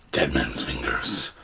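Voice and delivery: slow, low eerie whisper. Pretend. Naming the drink in a slow eerie whisper. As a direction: breathe the name like a ghost story.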